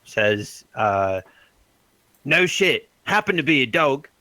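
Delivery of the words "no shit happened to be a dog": dog's cheeky retort. Acted. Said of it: "And then Petey's like".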